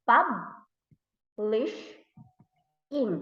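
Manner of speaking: "publish in" as pub, lish, ing with long gaps; syllable by syllable. Shown as a demonstration. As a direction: break "publish in" into three slow beats. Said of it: "there are also three syllables".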